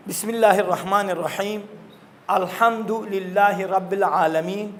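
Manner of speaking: slow, drawn-out tilawat with long rising lines; Quran recitation. Acted. Teacher reciting Surah Fatiha for the children to repeat, slow tilawat. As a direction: recite each verse slowly and melodically.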